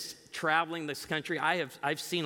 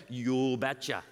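lower, drawn-out Norwegian impression; thick Norwegian accent; 3 semitones lower, slower than usual. Father-in-law agrees to college deal, thick Norwegian accent. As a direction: mimic the Norwegian father's reply. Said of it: "in his thick Norwegian accent".

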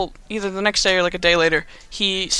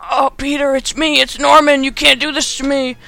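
high, hammed-up villain pleading; Green Goblin; 7 semitones higher, 4 dB louder than usual. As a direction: mock-desperate begging, louder in the middle. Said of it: "Green Goblin's like".

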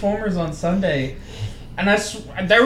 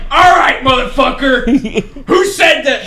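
loud, raised imitation shout; mock angry challenge; 5 semitones higher, 8 dB louder than usual. Father with baby challenges the theater, mock furious. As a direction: play an enraged dad looking for a fight, amused.

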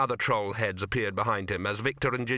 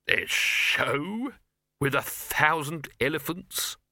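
raised, slow, drawn-out scorn; nasty; 4 semitones higher, much slower than usual. A narrator's reading. Silverfish scorning the elephant show, drawn-out and nasty. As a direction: spit out the idea with slow distaste. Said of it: "he added nastily".

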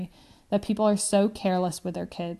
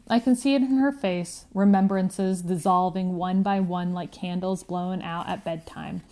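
ordinary delivery, nothing marked

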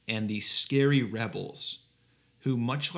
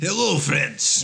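slow, higher, louder mock Russian accent; cod Russian accent; 4 semitones higher, 6 dB louder, slower than usual. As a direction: greet like a cartoon Russian. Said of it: "demonstrate a cod Russian accent".

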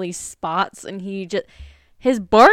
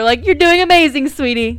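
mock encouragement; 6 semitones higher, 9 dB louder than usual. Mock praise for the commenter.

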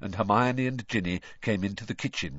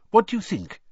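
nervous, eager question, higher; anxious; 13 semitones higher, 6 dB louder than usual. A narrator's reading. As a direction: ask anxiously, hoping for approval. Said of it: "he asked anxiously".

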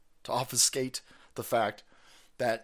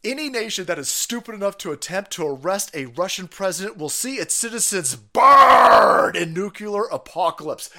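reading the threat; 4 semitones higher, 7 dB louder than usual. Retold or imitated.

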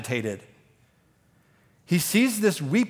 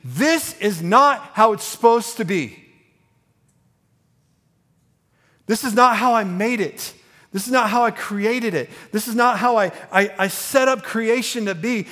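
impassioned protest, higher and louder; righteous anger; 6 semitones higher, 5 dB louder than usual. Retold or imitated. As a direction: voice the Lord's outrage at death's grip. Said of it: "there's this righteous anger in him saying".

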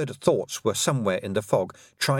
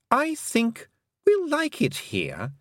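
higher, silky voice of a mocking fairy queen; lightly higher, condescending queen; 13 semitones higher, slower than usual. A narrator's reading. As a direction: purr it out as the cruel Queen, sweetly taunting.

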